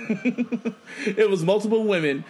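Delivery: laughing. Real, not acted. Repeats it, breaking into laughter.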